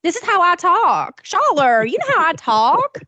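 mock Mississippi accent. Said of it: "Why are you talking like that".

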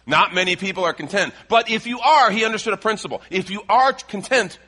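high, loud sermon climax; impassioned preaching. Real, not acted. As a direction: drive the point home to the congregation at full voice. Preaches the climax at full force.

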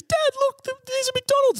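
put-on high childish little-boy voice; high-pitched kid calling out to dad; kid brother voice. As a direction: voice the excited little brother pointing.

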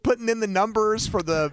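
ordinary talk

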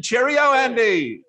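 high, squeaky Cheerio in a fake Australian accent; mock British-Aussie accent. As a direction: camp it up, way up high, Aussie style. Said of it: "Holy crap. That was funny".

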